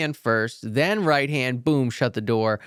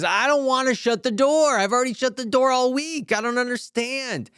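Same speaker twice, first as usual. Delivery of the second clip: higher, whining student, fed up with the door; whiny frustrated student; 11 semitones higher than usual. Retold or imitated. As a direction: complain like a sulky pupil.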